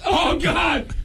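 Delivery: mock agony. Mock agony over food poisoning.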